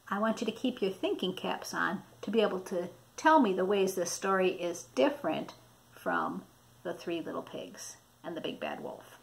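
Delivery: plain reading voice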